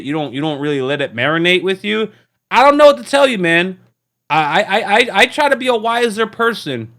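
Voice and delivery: ordinary delivery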